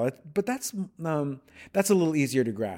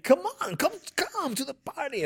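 much higher, lively Portuguese cheer; festive Brazilian party invite. Retold or imitated. Imitating Brazilians welcoming someone to a party, high and festive. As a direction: invite them in like it's a party. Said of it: "if we keep thinking, like".

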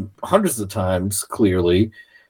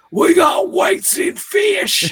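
mob shout; slower than usual. Retold or imitated. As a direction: bellow it like an angry man at a weigh-in. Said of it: "the dude going".

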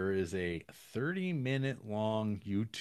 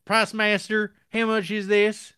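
mock customer call; 12 semitones higher, 9 dB louder than usual. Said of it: "as he addresses you upon asking".

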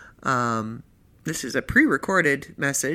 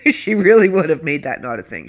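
laughing; 2 semitones higher, 7 dB louder than usual. Real, not acted. Laughing after her own remark.

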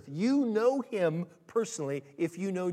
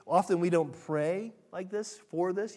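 usual speaking voice

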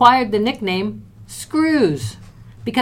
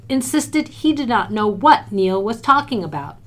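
ordinary delivery

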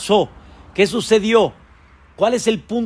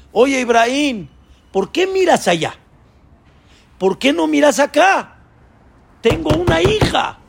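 much higher pleading servant's voice; Eliezer pleading; 8 semitones higher than usual. Acted. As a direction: coax the master to look his way. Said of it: "dice Eliezer".

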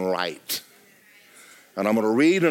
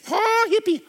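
squeaky high mock of a hippie. Preacher mocking a hippie, in silly high falsetto. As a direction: toss off a silly falsetto caricature.

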